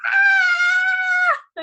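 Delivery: long, high, loud scream. Retold or imitated. Cliff jumper dropping from a pulley, re-enacted scream. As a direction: re-enact a full-out drawn-out scream. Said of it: "you just hear me screaming".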